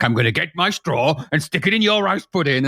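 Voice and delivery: lightly menacing film-villain voice, a touch of Daniel Day-Lewis; half-hearted Daniel Day-Lewis villain threat; Daniel Day-Lewis impression. Host retelling his dinner-table Daniel Day-Lewis impression, a light menacing voice. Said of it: "I was just impersonating Daniel Day-Lewis".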